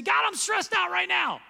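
high, loud anguished cry to God; stressed-out prayer. Shown as a demonstration. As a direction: shout it out like a desperate plea. Acted prayer shows stress.

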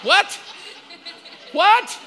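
husband shocked by his wife's remark, incredulous. Retold or imitated. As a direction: act stunned at your wife. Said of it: "stunned reaction".

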